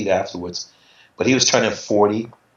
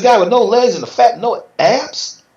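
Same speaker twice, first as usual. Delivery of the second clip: much higher and louder, mocking; scornful disbelief; 11 semitones higher, 5 dB louder than usual. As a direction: scoff at the rival's body. Mocking his rival.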